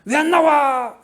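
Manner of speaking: high, loud impatient call from outside; the husband waiting by the car; husband yelling why she isn't out yet. Retold or imitated. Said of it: "그런다는 거예요".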